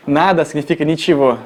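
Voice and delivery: ordinary delivery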